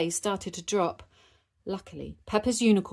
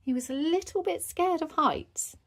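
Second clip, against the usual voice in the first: plain reading voice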